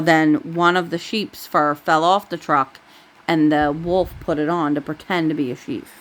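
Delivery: plain reading voice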